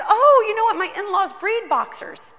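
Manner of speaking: high, loud burst of delight; excited. Retold or imitated. To the pastor, the caregiver exclaims with high, loud delight.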